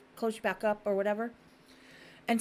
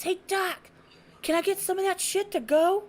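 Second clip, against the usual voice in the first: high, loud imitated plea; mock eager calling; 9 semitones higher, 4 dB louder than usual. Imitating drugged ex-husband to his doctor, mock eager calling. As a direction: call out like an eager patient on good drugs.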